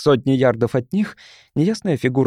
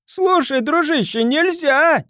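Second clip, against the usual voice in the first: much higher, shrill and squeaky; 14 semitones higher, slower than usual. Acted. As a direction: screech it out in panic. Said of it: "визгливый голос Чарли".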